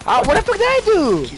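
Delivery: high, loud cry of disbelief; gamer's outraged shout; frustrated yell. Real, not acted. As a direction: yell it at the screen. Gamer yelling after dying.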